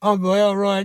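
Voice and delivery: usual speaking voice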